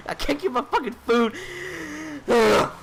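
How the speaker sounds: exasperated groan. Real, not acted. Frustrated rant about line cutting.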